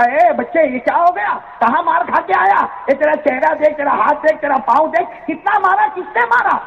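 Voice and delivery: high, alarmed questions rising further; shocked passer-by. Acted. Stranger shocked at beaten child.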